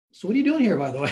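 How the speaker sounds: grilling the suspicious man with mock innocence; pointed question. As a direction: put him on the spot, loud and bright. Needling the thief on purpose.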